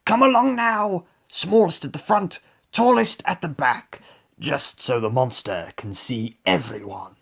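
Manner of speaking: high, loud lion giving orders; animated commanding lion, easing at the end; lion leader. A narrator's reading. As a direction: marshal the troop as a storybook lion. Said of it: "he said".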